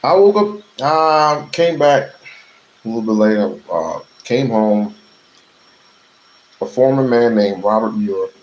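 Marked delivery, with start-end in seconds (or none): 0.0-3.6 loud. Real, not acted. Sudden loud restart.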